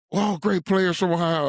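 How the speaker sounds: high, loud retold coach line; mock coach booming his motto; Woody's line. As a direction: bark the slogan like the old coach. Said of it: "And he said".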